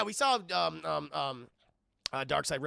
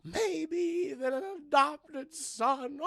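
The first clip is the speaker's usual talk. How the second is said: slow, high taunting Joker; mock Joker sing-song; 7 semitones higher, slower than usual.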